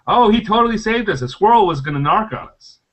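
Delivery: high, eager retold defense; earnest excuse. Dwarf explaining the dead squirrel to party, eagerly vouching. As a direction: vouch for a friend with mock conviction.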